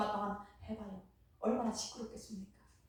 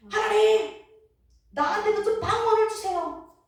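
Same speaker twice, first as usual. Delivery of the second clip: loud, high desperate cry to God; crying out loudly for the gift of tongues; shouting prayer; 6 semitones higher, 11 dB louder than usual. Retold or imitated. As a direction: yell it with ears covered, begging. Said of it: "어떻게 소리치는지 압니까?".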